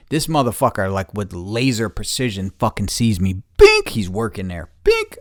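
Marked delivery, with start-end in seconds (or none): 3.6-3.9 a sudden very high, loud "Bink!"; ping it out like a targeting beep; sound effect ping. Retold or imitated. Coworker spots him instantly, high beep sound. Spotted with laser precision. 4.8-5.2 ping it out like a targeting beep; sound effect ping. Retold or imitated. Coworker spots him instantly, high beep sound. Spotted with laser precision.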